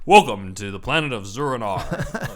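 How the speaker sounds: booming announcer-style quote; belted villain welcome; loud quote. Retold or imitated. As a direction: proclaim it loud and grand. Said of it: "he says at one point".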